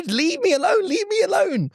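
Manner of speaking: high, squealed mock panic; comic high-pitched desperation; panicked plea. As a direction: plead desperately while being chased. Pleading while chased by the dog.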